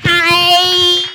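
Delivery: normal talking voice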